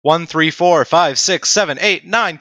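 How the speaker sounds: normal talking voice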